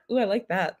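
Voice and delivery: ordinary talk, nothing marked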